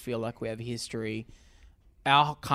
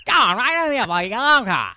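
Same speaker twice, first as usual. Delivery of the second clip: ocker Australian; 11 semitones higher, 10 dB louder, faster than usual. Retold or imitated. Joins in ocker voices.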